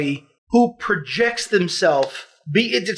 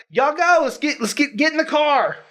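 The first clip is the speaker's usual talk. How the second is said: 1 mock yell from the shower; 7 semitones higher than usual. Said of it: "I'm yelling from the shower".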